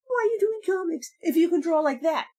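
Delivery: high mock bewildered question; retelling the critic's demand, high and baffled; incredulous. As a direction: press the why in disbelief.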